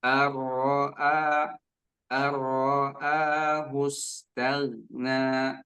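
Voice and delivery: very slow ar-ra'ahu stagna, short ra, no bounce. Shown as a demonstration. Teacher corrects the student's ra. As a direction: stretch it, keep gha still. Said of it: "Roknya tidak dipantulkan".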